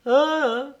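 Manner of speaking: very high, rising bird squeak; loud little bird echo; cheeping bird. A narrator's reading. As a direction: peep the question like a bird. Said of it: "piaula le bec".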